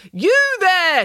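high, loud, insistent hail; calling out. A narrator's reading. Nanny hailing a jogger in earphones, loud insistent call. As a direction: holler it down to the street. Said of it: "she called again".

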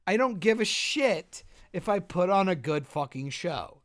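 loud, high, indignant rant with slow punches; angry rant. Real, not acted. Ranting to his cohost about actors' pay, loud and furious. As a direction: hammer each point home in outrage. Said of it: "I'm so angry".

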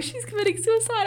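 mock high voice. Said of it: "And you were like".

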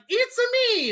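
put-on high Italian accent Mario line; fake Italian accent. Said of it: "the fake Italian voice".

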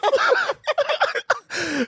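a high, shrieking burst of laughter. Real, not acted. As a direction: crack up with a squeal of laughter. Cracking up at Corey's tease.

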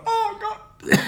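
squeaky high yelp of pain, laughing; hammed-up high-pitched "Oh, God" of pain; pained squeal. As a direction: cry out in mock agony through a laugh. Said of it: "I've gone".

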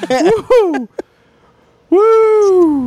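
high shrieking mock whoop; shrieking jackpot winner. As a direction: screech it like a winner. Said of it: "really lame woo noises".

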